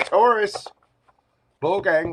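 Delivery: calling out. Real, not acted. Host greets Taurus viewers with a loud bright call. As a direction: hail the sign like a host.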